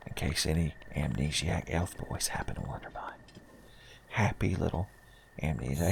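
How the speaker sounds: soft, gentle whispered narration; soft painter narration. Acted. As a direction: whisper it like a calm painting show host.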